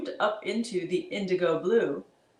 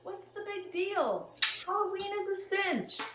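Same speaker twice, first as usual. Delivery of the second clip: put-on high girl voice; 12 semitones higher, 5 dB quieter than usual. Hannah answers the monsters.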